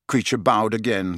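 ordinary delivery, nothing marked

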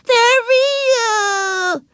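high, loud tearful wail; bawling little girl; crying. A narrator's reading. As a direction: wail it out, stretching the last word. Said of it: "cried Susan".

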